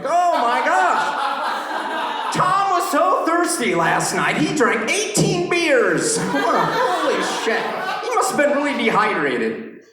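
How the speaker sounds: high, laughing, gushing gossip voice; mocking gossip voice. Comic mocks friends gossiping about his thirst, high laughing voice.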